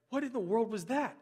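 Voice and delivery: acted-out disbelief, voice lifted; baffled reaction. Retelling his baffled reaction.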